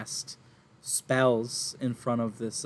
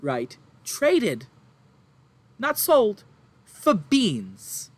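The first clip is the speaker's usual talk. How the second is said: high, loud, tearful mother pleading; distraught farm woman; 6 semitones higher, 5 dB louder than usual. Acted. Farm woman to adventurers, upset and near tears. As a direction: play the frantic farmwife near tears.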